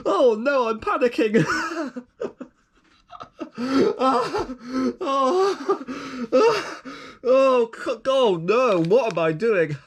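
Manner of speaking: high, breathless laughing through the words; can't stop giggling, real panic-laughter; real giggle fit. Real, not acted. While unboxing, to the viewers, a real giggling fit. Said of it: "I don't normally speak like this".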